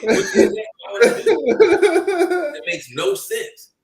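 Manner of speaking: high, slow words broken by real laughter; laughing disbelief. Real, not acted. To friends about the Yu-Gi-Oh hero, laughing in disbelief. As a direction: laugh it out, can't believe it.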